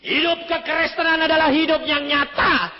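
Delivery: loud, high sermon voice; fiery preaching. Acted. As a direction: preach it out to the hall. Preaching at full force. (khotbah berapi-api)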